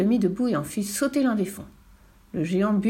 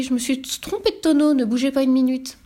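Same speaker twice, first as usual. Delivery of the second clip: high, louder acted fluster; flustered apology; 5 semitones higher, 4 dB louder than usual. A narrator's reading. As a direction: excuse yourself in raised, agitated pitch. Sorcerer hastily corrects his mistake.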